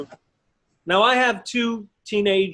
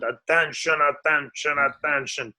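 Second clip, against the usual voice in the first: lightly lowered captain announcement; mock captain at dinner, a bit lower; captain impression; 5 semitones lower than usual. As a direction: joke around in the captain's voice. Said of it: "Do, do the captain".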